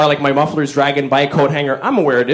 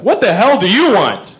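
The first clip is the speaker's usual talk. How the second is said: slow, a bit louder rude snap; rude clerk; 4 semitones higher, 4 dB louder, slower than usual. Retold or imitated. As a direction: bark it like an irritated night clerk. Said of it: "He's like".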